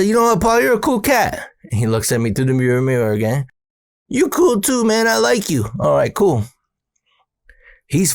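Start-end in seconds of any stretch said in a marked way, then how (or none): 0.0-1.5 mock cool talk. Teller voicing himself, high mock-cool. 4.1-5.7 high, simple sweet voice; a lightly high innocent voice; Paul's voice. Retold or imitated. Paul voiced, much higher.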